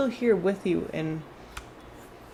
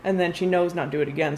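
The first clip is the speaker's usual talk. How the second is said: ordinary talk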